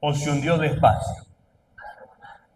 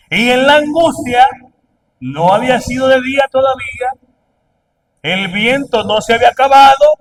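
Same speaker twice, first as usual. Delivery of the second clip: loud, higher preaching of the storm; intense preaching; 6 semitones higher, 9 dB louder than usual. Acted. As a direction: drive the drama home. Preaching at full force.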